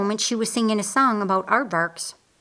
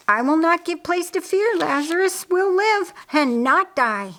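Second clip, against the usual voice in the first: defiant; 9 semitones higher, 3 dB louder than usual. A narrator's reading. Mary declares faith defiantly.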